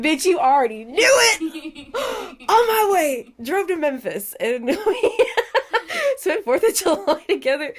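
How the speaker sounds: high, loud, laughing squeal; excited. Retold or imitated. Telling her friend she's on her way, squealing with joy. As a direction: burst out giddy and thrilled.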